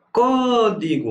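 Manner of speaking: Código with a high, long "Có"; Código called out, stretched on the stressed "Có"; stress on the antepenultimate. Shown as a demonstration. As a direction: hold the first syllable of Código, then fall. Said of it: "Última, penúltima".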